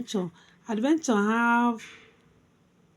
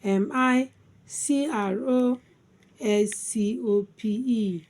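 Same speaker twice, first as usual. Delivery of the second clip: letters recited in groups with pauses; spelled by syllables. Shown as a demonstration. Teacher spelling microscope for learners, syllable by syllable. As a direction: spell it out, settling on the last chunk. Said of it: "Microscope divided into three syllables".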